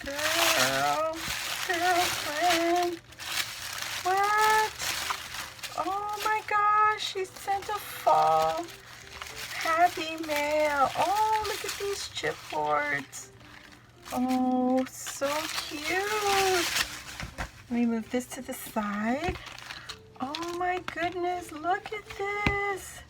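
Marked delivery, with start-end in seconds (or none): 0.0-16.9 much higher, slower delighted squeal; squealing excitement. Real, not acted. Unboxing a friend's gift to her viewers, squealing. Opening friend's fall happy mail. 20.2-23.0 squealing excitement. Real, not acted. Unboxing a friend's gift to her viewers, squealing.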